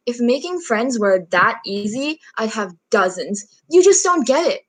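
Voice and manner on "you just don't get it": higher, louder frustrated outburst; acted teenage exasperation; exasperated. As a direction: burst out at a mom who doesn't understand. Acted argument with mom.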